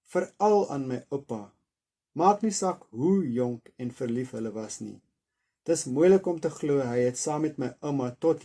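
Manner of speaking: steady, expressive reading of an Afrikaans novel; story narration. A narrator's reading. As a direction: read the chapter aloud like a storyteller. Reads Afrikaans novel aloud.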